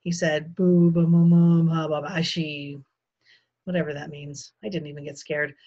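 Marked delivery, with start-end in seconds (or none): 0.5-2.8 loud nonsense-syllable babble; baby babbling. A narrator's reading. Toddler in his crib at night, babbling loudly. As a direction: babble like a small child. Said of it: "when Fudge started babbling".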